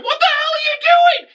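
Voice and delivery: very high-pitched mock shout; outraged yell. Imagining a reaction to the drone, high outraged yell. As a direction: yell it in exaggerated disbelief.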